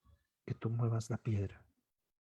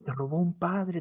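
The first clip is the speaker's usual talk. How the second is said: normal talking voice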